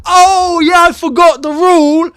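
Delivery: loud, high, elated explanation; excited high-pitched realisation; gleeful excitement. Real, not acted. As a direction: explain the rule in a delighted high shout. Gleefully recalling the offside rule.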